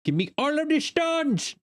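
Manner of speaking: booming high Viking battle cry. Acted. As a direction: bellow it like a raiding Viking. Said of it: "Raid introductions in the voice of a Viking".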